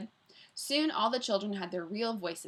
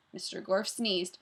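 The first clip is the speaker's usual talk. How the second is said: ordinary delivery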